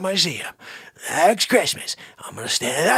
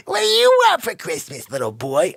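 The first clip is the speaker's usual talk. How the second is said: Santa voice; 3 semitones higher, 6 dB louder than usual. Retold or imitated. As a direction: boom it like a mall Santa. Santa voice in retold scene.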